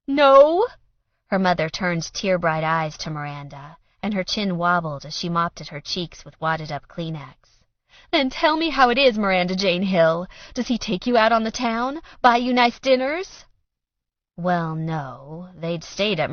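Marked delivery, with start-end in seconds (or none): 0.1-0.7 high, loud incredulous no; sharp challenge. A narrator's reading. Mother challenging daughter, high and loud. 8.1-13.5 high, loud scolding; full-name scolding; demanding. A narrator's reading. Mother scolding daughter by full name, loud.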